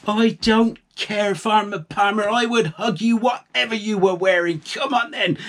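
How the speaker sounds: a mum gushing over her grown son; doting mum. Acted. Mother reassures son.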